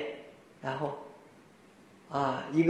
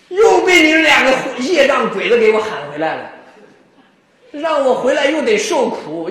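angry scolding; 3 semitones higher, 7 dB louder than usual. Retold or imitated. Revived cowherd berates his two friends, hammed-up angry scolding. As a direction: scold them like a man dragged back from paradise. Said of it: "气死了".

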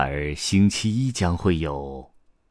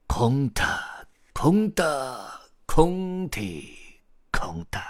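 higher spell, dropping on the last word; magic incantation; 8 semitones higher, much slower than usual. A narrator's reading. Grandpa Storyteller ends story time for the chicks, incanting. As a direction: chant it like a magician's closing spell.

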